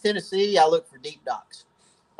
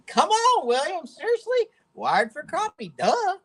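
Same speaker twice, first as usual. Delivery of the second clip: high, teasing "seriously?"; playful disbelief at an obvious question; mock incredulous. Real, not acted. Host teasing viewer William with squeaky mock disbelief.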